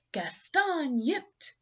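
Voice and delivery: plain reading voice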